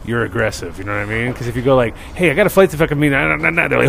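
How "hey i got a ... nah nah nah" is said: higher, hurried babble; mock a passenger in a rush, trailing into nah-nah; rushed talk. Passenger late for a flight to TSA, rushed babble. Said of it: "you talk too fast".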